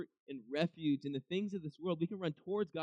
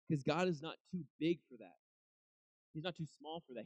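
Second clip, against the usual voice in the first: normal talking voice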